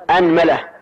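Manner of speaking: أنملة said with a on the hamza, anmula; أنملة with open a first, anmula; anmula with fatha. Shown as a demonstration. Teacher demonstrates the first variant to his students, a-nmula. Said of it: "ماذا نقول؟".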